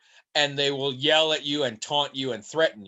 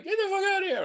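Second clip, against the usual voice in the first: much higher mock Spartan soldier, Greek accent; faster than usual. Player imitating a Spartan soldier's Greek-accented warning. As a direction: bark it like a faceless Spartan guard. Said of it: "Random faceless Greek sol-, Spartan soldier".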